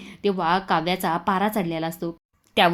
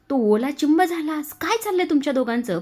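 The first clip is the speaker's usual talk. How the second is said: much higher, exasperated; mother scolding the drenched couple; scolding shout; 8 semitones higher than usual. Acted. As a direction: tell them off in disbelief. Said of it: "फार ओरडते".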